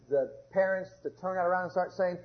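ordinary talk

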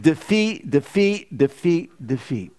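echoing "defeat" that dies to almost nothing; fading repetition. Acted. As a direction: let each "defeat" sink until it nearly vanishes. Repeats defeat, dying away.

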